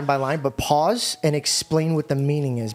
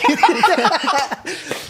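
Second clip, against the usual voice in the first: loud high laugh; 12 semitones higher, 5 dB louder than usual. Real, not acted. Guy laughing at his own money story, loud and high. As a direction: burst of real laughter, pitched up.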